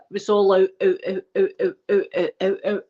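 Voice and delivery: imitating coughing with no breath in; breathless coughing fit. Showing viewers how the coughing left no breath in, imitated fit. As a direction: mimic helpless, repeated coughed-out bursts. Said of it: "like that".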